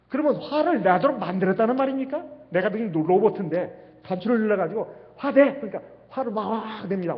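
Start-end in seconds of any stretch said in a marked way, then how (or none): 5.2-5.6 sharp command. Acted. Button-pressed command to get angry.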